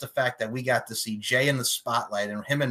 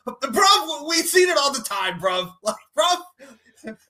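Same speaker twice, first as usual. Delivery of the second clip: high, loud London "bruv" retort; mock London accent; 14 semitones higher, 5 dB louder, much slower than usual. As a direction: fire it back like a London lad. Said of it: "And I'm like".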